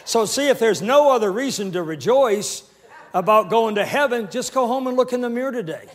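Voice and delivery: loud, high, jubilant preacher; full-force preaching. Real, not acted. Preacher rejoicing over a heavenly body, full-force preaching. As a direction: build to a shouted praise.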